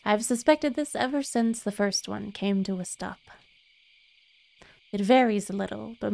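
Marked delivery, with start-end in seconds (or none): none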